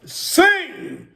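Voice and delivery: high-pitched, short call to join in. Real, not acted. Leader to the congregation mid-chant, high call. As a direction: urge them all to sing.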